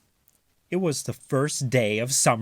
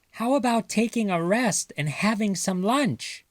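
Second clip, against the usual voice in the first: higher Mother voice calling out; 9 semitones higher than usual. Acted. Mother calling the mice in for lunch, higher and sing-song. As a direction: call the kids in for lunch.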